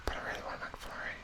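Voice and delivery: quick wistful whisper. Real, not acted. Hungry quiz host whispering a craving. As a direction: whisper it, longing. Whispered aside about McFlurry.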